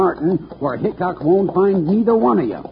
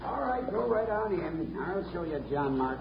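faint, distant voice from outside; much softer, heard from down the cave; far off; 13 dB quieter than usual. Acted. Villain approaching outside the cave.

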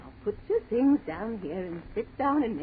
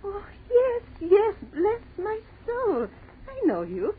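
put-on overjoyed old aunt; 9 semitones higher, 4 dB louder than usual. Old aunt recognizes Alice.

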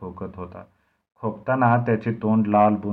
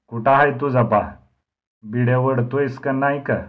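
angry interrogation; 4 semitones higher, 8 dB louder than usual. Acted. Dnyanu interrogates the boy.

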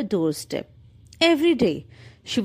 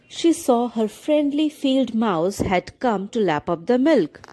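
usual speaking voice

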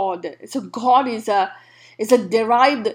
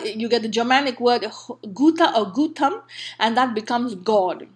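ordinary talk, nothing marked

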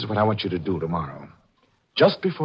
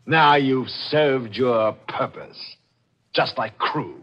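very slow, pitched-up, measured menace; deliberate menace; 5 semitones higher, much slower than usual. Acted. Killer tells victim her use is over, slowly and menacingly. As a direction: spell out the doom step by step, slowly.

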